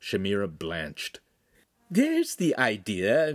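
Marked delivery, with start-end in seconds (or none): 1.9-3.3 higher, slow and bright-spirited lady; pleased as can be; cheerful. Acted. Signora delighted at the fresh chicken, cheerful. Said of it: "Mistress Cagliari said cheerfully".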